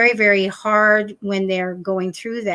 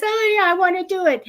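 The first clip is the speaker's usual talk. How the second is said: eager empath; 6 semitones higher, faster than usual. Retold or imitated.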